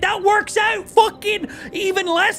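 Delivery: very high, drawn-out exasperated rant; retold yelling. Streamer re-enacting a yell at friend over game prices, exasperated. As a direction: scold a friend slowly in mock fury. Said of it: "I love yelling at Flame".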